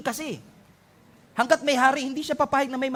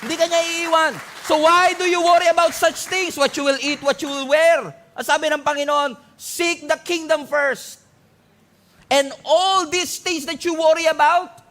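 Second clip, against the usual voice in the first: preaching at full force; 5 semitones higher, 4 dB louder than usual. Acted.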